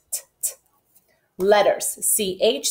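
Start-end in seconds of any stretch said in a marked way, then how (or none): 0.1-0.5 crisp voiceless t, t; whispered t sound. Shown as a demonstration. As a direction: tap out the t sound softly. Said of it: "sound t".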